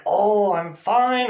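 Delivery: high, drawn-out and loud reply; cheery reply. Shown as a demonstration. Interviewee answering a greeting, cheery and drawn out.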